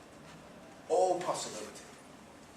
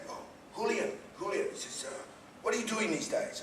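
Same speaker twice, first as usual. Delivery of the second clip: put-on Spanish accent; 8 semitones higher than usual. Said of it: "I'll attempt it for you".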